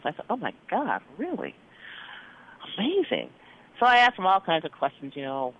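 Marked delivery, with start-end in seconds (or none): 0.3-3.3 higher, hushed astonishment; amazed. Retold or imitated. Doctor hears the old woman keeps house, softly amazed. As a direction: let the amazement lift the pitch. Reacting to grandmother's vigor.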